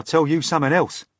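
quick, sharp warning. Acted. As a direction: snap it out fast and pointed. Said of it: "the centipede said sharply".

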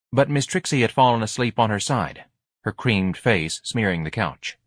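plain reading voice

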